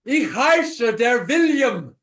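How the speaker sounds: slow, loud hammed-up yell. Shown as a demonstration. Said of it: "Louder, [name], louder".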